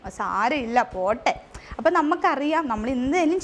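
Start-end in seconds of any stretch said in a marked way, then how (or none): 0.1-1.3 imitating a mother gently saying never mind; reassuring mother. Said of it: "അമ്മ ഉടനെ react ചെയ്യും".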